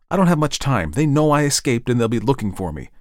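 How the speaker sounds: urgent Ed. Acted. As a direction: tell them urgently, as a hunted man.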